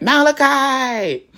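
high, loud, drawn-out holler; nephew's copycat yell. Retold or imitated. Little nephew copying his aunt's yell, long imitated holler. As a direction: mimic a little kid echoing the yell, stretching it out. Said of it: "you hear my little nephew".

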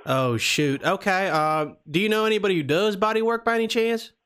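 put-on Southern prank caller; Southern accent. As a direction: talk like a folksy Southern customer. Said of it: "we're doing the Southern accent".

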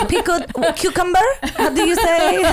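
high, laughing fake Spanish accent; mock a put-on Spanish accent, groping for the word; Hilaria Baldwin. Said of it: "It's very Hilaria Baldwin".

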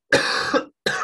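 hard, chesty hacking cough; coughing fit. Real, not acted. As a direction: cough it out roughly.

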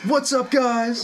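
high, slow hyped-up greeting; big energetic hello. Acted.

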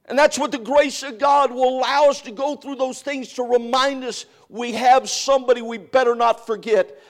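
high, loud preacher's fervor; preaching at full force. Acted. Pastor exhorting the church, high and loud. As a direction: drive the message home to the congregation.

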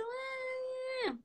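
thin, high, very soft drawn-out whine; feeble voice demo. Shown as a demonstration. Coach mimics the too-timid voice to avoid, feeble high whine. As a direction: imitate a tiny wordless voice that barely comes out. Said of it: "e nem uma voz muito".

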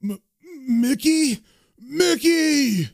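high, pained plea building to a wail; stammer, then cry out for help; hammed-up whimper rising into a desperate call; stammering panic. Stabbed man calls his friend.

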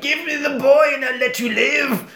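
high, strained killer-doll demand; menacing doll. Acted. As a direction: threaten her in a high doll voice. Burnt doll threatens mum.

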